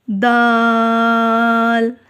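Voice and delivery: दाल stretched very long on the आ; long drawn daal. Shown as a demonstration. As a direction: hold दाल as long as जीम. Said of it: "ये भी जीम के जितना लंबा होगा". (दाल को लंबा खींचकर)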